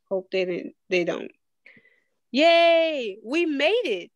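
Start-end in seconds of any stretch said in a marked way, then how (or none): 2.3-4.1 bright high cheer, a notch louder; a child cheering at arriving on time; exclaiming. A narrator's reading. Eva cheers to her mother, arriving at the theater, excited. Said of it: "Eva ex-, exclaimed".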